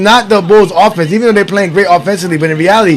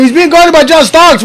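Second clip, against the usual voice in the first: higher and louder, mocking "guarded"; gleeful repeat; 9 semitones higher, 4 dB louder than usual. Real, not acted. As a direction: crow it out in disbelief. Repeat while laughing.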